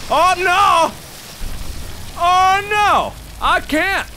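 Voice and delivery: high, dismayed wail; high frantic gamer yelp; panicked cry. Real, not acted. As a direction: cry out as the path closes. Trapped again in game.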